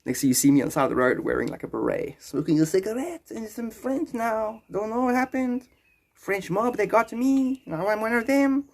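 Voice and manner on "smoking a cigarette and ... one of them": high, put-on French-mob voice; converted French character. Man taken by the French mob, high comic voice. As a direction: drift up into a silly French character voice.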